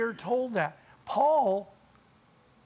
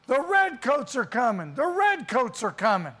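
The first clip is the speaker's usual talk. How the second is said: raised, urgent town-crier warning; alarm cry; 4 semitones higher, 4 dB louder than usual. Acted. Night rider waking townsfolk as soldiers approach, a moderately raised warning cry. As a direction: sound the alarm, higher and firmly projected. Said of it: "waking up in the middle of the night".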